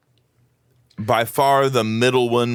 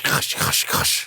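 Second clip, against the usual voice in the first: breathy gosh crunch sound; crunch noise; slower than usual. Shown as a demonstration. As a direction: voice the ice crunch. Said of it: "I'd do more of a gosh".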